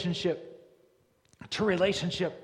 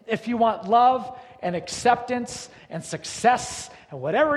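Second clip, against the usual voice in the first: loud, building preacher's cadence, climbing higher; preaching at full force; 3 semitones higher, 7 dB louder than usual. Acted. Pastor rousing the congregation toward God, loud building preaching. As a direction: drive each line home to the crowd.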